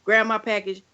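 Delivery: ordinary delivery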